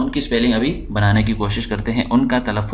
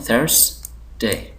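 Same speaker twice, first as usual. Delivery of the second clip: Thursday slow and softer, in two beats; syllable by syllable; 5 dB quieter, much slower than usual. Shown as a demonstration. Teacher showing the two syllables, Thursday slowly split. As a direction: pause between THURS and day in Thursday. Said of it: "इसके अंदर भी दो syllable हैं".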